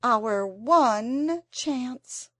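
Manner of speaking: slow, drawn-out dramatic cry; milk it like a stage heroine. A narrator's reading. Anthea dramatically announces a last hope to Jane. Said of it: "cried Anthea dramatically".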